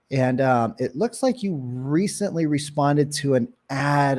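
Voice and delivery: slow, hesitant opener with long pauses; taking time. Shown as a demonstration. Agent calling a lead who answered an ad, slow and unhurried. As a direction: stretch the key words and leave easy gaps, never rushing. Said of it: "take a little bit of time to say that".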